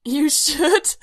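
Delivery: loud, high attempt through laughter; breathless with laughter. A narrator's reading. Man laughing too hard at droid's voice to finish. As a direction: try to speak while cracking up. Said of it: "Bodhi tried, but couldn't get enough air".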